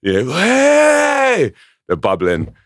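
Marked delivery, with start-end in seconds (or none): 0.2-1.5 long, high-pitched festival cheer; drawn-out high "hey"; crowd cheer. Retold or imitated. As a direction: whoop like a crowd hearing the beat. Imitating crowd cheer at music.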